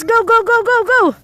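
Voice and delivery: quick, high chant of encouragement; excited cheering on. Real, not acted. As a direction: urge the little player on. Cheering child on to kick.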